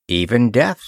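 plain reading voice

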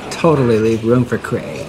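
low, drawling parody of the barista; mocking affected voice. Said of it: "Why are you talking like that?".

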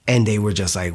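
ordinary delivery

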